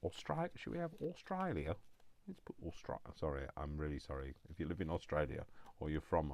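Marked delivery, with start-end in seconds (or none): 0.0-3.0 1 mock Australian. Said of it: "I didn't mean to murder your accent".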